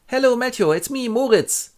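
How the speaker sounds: high, loud playful ghost voice; cheerful headless ghost saying hi; ghost Moritz. Acted. Ghost greets friend.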